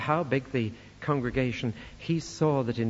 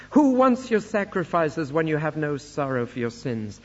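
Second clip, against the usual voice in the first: raised, emphatic divine rebuke; indignant scripture declamation; 3 semitones higher, 5 dB louder than usual. Acted. Preacher reads God rejecting Israel's sacrifices, indignant declamation. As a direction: declaim it with rising force, like a fed-up God.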